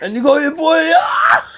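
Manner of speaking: teasing shout. Real, not acted. Friend teasing, shouting and laughing.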